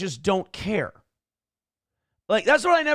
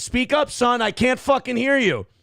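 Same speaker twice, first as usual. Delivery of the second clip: higher, louder barking at the caller; mock yell; 7 semitones higher, 3 dB louder than usual. Telling the timid caller to speak up, mock yell. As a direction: bark it like an angry coach. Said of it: "he definitely got yelled at".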